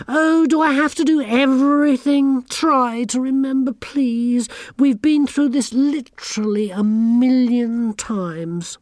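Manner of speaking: high, loud and fed up; exasperated bossy girl. A narrator's reading. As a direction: sigh at everyone's uselessness. Anagramma exasperated.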